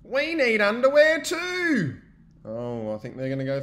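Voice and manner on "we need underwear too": eager friends. Acted. Friends demand underwear.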